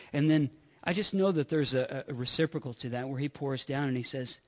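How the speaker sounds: normal talking voice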